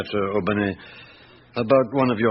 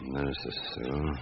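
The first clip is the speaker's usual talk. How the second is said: much softer, repeating the name while noting it; murmured to himself; 7 semitones lower, 12 dB quieter than usual. Acted. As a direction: mutter the name back under your breath. Inspector noting down the address.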